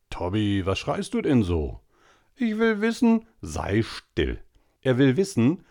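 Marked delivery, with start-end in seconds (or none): 2.4-3.3 put-on high, insistent little boy; high whiny boy. A narrator's reading. Tobi insisting to Onkel Adolf, high and whiny. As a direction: insist like a stubborn child.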